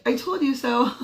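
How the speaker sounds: mock I-told-you-so. Mom needling daughter at the register, mock I-told-you-so. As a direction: overplay a little told-you-so. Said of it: "Not like that, but almost".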